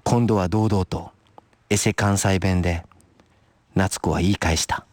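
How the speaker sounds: low, unhurried narrator closing a tale; story narration. A narrator's reading. Narrator closing the story of Natsuko's retort, low measured narration. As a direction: read it low, pausing between phrases.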